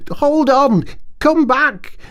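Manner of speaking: high, loud, eager Mole voice; excited Mole. A narrator's reading. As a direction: urge Ratty back, bursting with excitement. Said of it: "full of joyful excitement".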